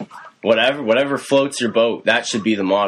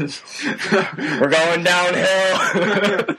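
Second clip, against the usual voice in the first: slow drawn-out; 10 semitones higher, much slower than usual. Pretend. Mock lament, very slow high.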